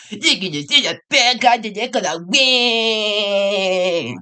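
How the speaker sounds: slow, drawn-out cartoon ad; kid's show ad voice. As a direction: announce it like a toy commercial. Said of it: "kid's show advertisement".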